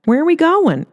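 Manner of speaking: high, flustered question; dragged off, confused; startled Earl. Acted. Earl pulled away.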